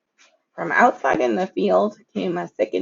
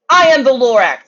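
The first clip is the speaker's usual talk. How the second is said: a hint of a sick Lorax, loud; coughing Lorax; 5 semitones higher, 6 dB louder than usual. A narrator's reading. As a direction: wheeze it out like a sick old creature. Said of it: "he coughed and he whiffed".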